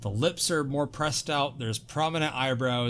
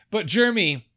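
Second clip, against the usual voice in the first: usual speaking voice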